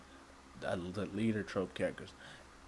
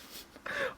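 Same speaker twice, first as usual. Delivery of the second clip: squeaky laugh; 6 dB quieter than usual. Real, not acted. Laughs at own claim.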